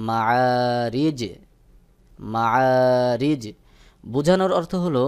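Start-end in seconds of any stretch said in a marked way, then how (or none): 0.1-3.4 মাআরিজ drawn out very slowly with a bouncing qalqala on the final জ; Quranic recitation demo. Shown as a demonstration. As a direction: recite মাআরিজ slowly, stopping on a sakin জ with an echo. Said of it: "তখন উচ্চারণ হবে". (মাআরিজ ধীরে, শেষে কলকলাসহ)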